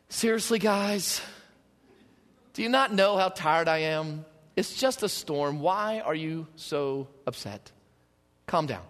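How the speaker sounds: low, soft voice, eyes still shut, unbothered; a half-asleep teacher amused by his panicking students; drowsy, smirking Jesus. Retold or imitated. Jesus, still lying down, telling the frightened disciples to calm down, drowsy and wry. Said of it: "I picture his eyes closed with a little smirk".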